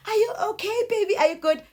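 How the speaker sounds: high cooing mother. Retold or imitated. Mother checking on her toddler, high tender cooing. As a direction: soothe the little one with a high coo.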